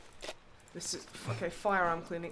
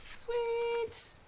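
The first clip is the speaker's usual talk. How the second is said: excited. Real, not acted. Finds a sewing kit.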